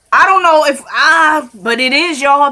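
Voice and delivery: can't contain it, burst out to the viewers; excited outburst. Real, not acted.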